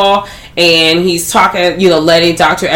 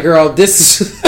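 cackling; slower than usual. Retold or imitated. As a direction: quote dissolving into a laugh. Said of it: "they're cackling".

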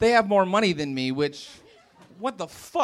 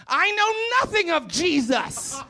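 high, slow, drawn-out declaration; odd biblical proclamation; 9 semitones higher, slower than usual. Retold or imitated. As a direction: imitate her weird, solemn pronouncement. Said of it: "this is really how she said it".

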